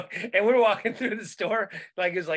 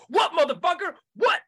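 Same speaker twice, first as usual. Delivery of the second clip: higher and louder, a furious street challenge; angry confrontation; 13 semitones higher, 6 dB louder than usual. Retold or imitated. Danny confronting the store worker, a loud angry challenge. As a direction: snap at him like he's tailing you. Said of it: "turns to him and goes".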